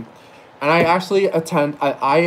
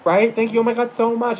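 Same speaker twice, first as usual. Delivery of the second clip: much higher gushing imitation; Sarah Silverman; 9 semitones higher than usual. Silverman thanks an admiring server, high gushing imitation. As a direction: imitate her, pitched way up and grateful. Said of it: "She's like".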